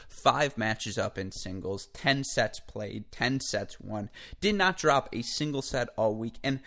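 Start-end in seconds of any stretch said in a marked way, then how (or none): none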